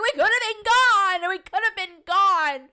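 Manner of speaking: exasperated complaint. Retold or imitated. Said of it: "I was like".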